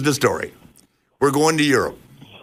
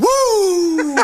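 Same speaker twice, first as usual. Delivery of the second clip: high whooping yell; rowdy party animal. Acted. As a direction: let out a wild woo. Flair's trademark hype yell.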